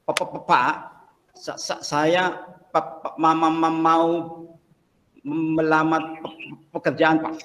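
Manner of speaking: stammering, syllables repeated haltingly; stuttering job seeker. Acted. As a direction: stutter through every word like a nervous applicant. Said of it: "tahu ya orang gagap ya?".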